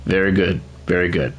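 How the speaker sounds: ordinary talk, nothing marked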